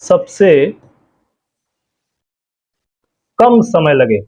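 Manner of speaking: phrase broken up by long pauses for writing; slow dictation. Shown as a demonstration. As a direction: read it out slowly for notes. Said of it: "इसमें बता दे रहे हैं आपको". (धीरे-धीरे बोलकर लिखवाना)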